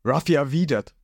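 ordinary delivery

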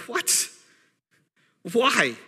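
startled cry of what; shocked. Retold or imitated. Daniel shocked at the messenger's news, high disbelief.

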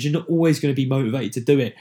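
normal talking voice

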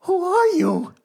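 high cracking Batman squeal. Shown as a demonstration. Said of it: "that's how you do it".

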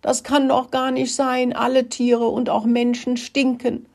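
high-pitched tearful protest; high, whiny storybook crying; acted weeping. A narrator's reading. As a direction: keep crying like an upset little rat. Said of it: "weinte er weiter".

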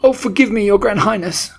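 high, loud begging; panicked, high-pitched apology; cried miserably. A narrator's reading. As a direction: plead with your ruler for your life. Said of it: "cried the miserable culprit".

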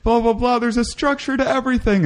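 awed convert. Pretend. Imagining a DMT dweller tripping on our reality, high, giddy, awestruck. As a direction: play a giddy newly converted believer, way up high. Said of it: "and be like".

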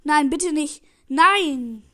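very loud pleading then a falling yell; desperate shout. Real, not acted. Gamer watching the enemy equalise, desperate loud shout. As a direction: shout in real despair as the goal goes in.

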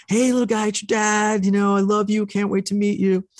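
high, sweet voice to the unborn son; baby talk to the belly. Retold or imitated. Dad speaking to his unborn son through the belly, high and cooing. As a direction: coo it up high like talking to a bump. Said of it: "I'm like".

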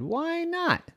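high rising mom question; questioning mommy. A narrator's reading. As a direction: ask in a high, puzzled mom voice. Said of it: "Mommy said".

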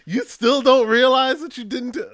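high, loud exasperated wail; mock exasperation. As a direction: wail it in comic disbelief. Acted exasperated reply to coworker.

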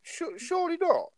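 sharp high disbelieving jump; surely not. Real, not acted. To his co-host, stunned and disbelieving. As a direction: pitch leaps up in real disbelief. Disbelief at GTA four claim.

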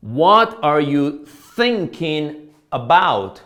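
very slow, loud teacher's model; drawn-out demonstration for learners; model example. Shown as a demonstration. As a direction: say it word by word, with pauses. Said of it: "Miren este segundo ejemplo".

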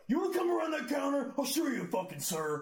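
high, loud threatening yell, easing slightly at the end; imitated angry shout. Customer threatening the clerk over being called sir, mock furious shout. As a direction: explode as the enraged customer.

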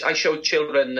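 ordinary talk, nothing marked

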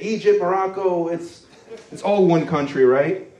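ordinary delivery

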